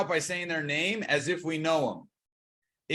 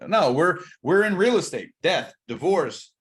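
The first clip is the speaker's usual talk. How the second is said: ordinary delivery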